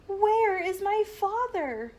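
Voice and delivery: high, loud, slow frightened question; panicked cry. A narrator's reading. Boy panics after scream.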